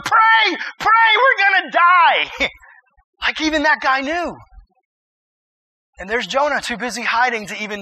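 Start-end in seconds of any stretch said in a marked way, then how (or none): 0.0-2.5 screamed high and loud, slow; ticked off captain shout. Acted. Furious captain screams at Jonah to pray, desperate shout. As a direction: yell it like you're about to drown. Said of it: "a ticked off captain going".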